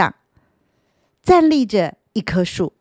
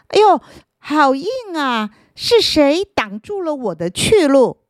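higher, louder startled cry; startled ouch; 8 semitones higher, 3 dB louder than usual. Acted. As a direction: bump into something hard. Root bumps into the stone.

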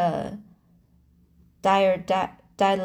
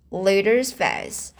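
usual speaking voice

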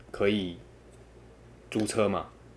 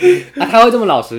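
laughing; 13 semitones higher, 13 dB louder than usual. Real, not acted. Laughing while answering the friend.

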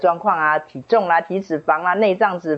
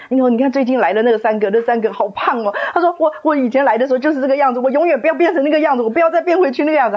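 higher, fast, anxious retelling; imitating scared girl; 8 semitones higher, faster than usual. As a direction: rush it like a frightened young woman. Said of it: "她好害怕".

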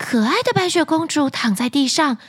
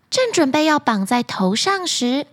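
usual speaking voice

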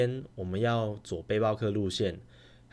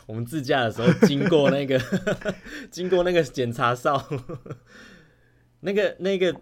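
laughing; 6 semitones higher, 6 dB louder than usual. Real, not acted. Giggling over pretty soldier.

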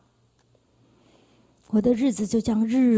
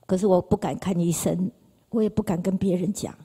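usual speaking voice

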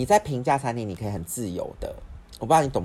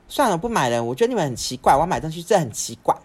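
loud, higher indignant outburst; huffy mom; 7 semitones higher, 8 dB louder than usual. Retold or imitated. Mom quitting the purchase in a huff, loud indignation. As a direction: throw it down like an offended mother.

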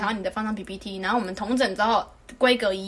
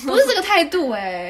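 louder, slower indignant protest; 7 semitones higher, 6 dB louder than usual. Real, not acted. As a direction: stress it like you can't believe it. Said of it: "indignant remark". (气愤地强调不是这个态度)

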